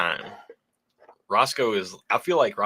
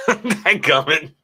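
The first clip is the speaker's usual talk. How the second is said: laughing; 7 semitones higher, 5 dB louder, much slower than usual. Real, not acted. Laughing at a donor's comment.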